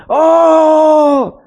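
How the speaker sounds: high, loud cheer; crowd cheer. Retold or imitated. Teens cheering for their leader, loud high whoop. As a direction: let out a big crowd whoop. Youth shouting back at retreat.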